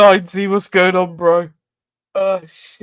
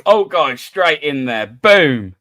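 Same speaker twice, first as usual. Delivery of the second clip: ordinary talk, nothing marked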